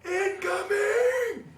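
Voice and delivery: long drawn-out yell, very high and loud; stretched alarm cry, high and loud; shouted warning. Retold or imitated. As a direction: scream it across the base as a warning. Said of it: "And you hear".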